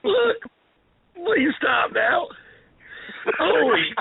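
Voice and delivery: winded, high squeaks after laughing; gasping for air after a laughing fit; breathless from laughing. Real, not acted. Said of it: "I need some air".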